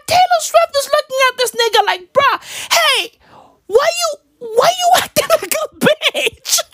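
very high, incredulous laughing squeal; high-pitched comic disbelief, breaking into laughter; laughing mock outburst. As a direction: crack up while acting it out. Comic outburst imagining Taylor's reaction.

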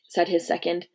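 ordinary delivery

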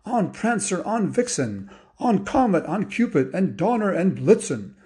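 high, sing-out roll call of reindeer; rousing Santa. Acted. Santa calls the reindeer to take off, rousing. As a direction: call each name out to the team. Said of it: "Santa said".

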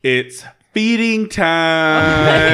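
long, drawn-out, high and loud call; mock feeding announcement. Friend announcing the snake's dinner, hammed-up excited call. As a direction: sing out each word, stretching it slowly.

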